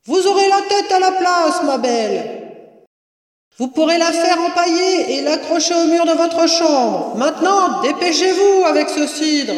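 high, projected voice from afar; calling down. A narrator's reading. Mistress hurries woman below, calling down. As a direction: shout instructions down into the cellar.

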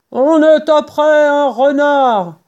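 high, drawn-out shouted reply; shouting back. A narrator's reading. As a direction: call back up from the pit, slowly. Boggis répond du fond. (réponse criée)

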